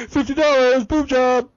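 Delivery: high, loud cartoon voice; Family Guy bit. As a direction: shout it like a cartoon hawker. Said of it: "sketch from Family Guy".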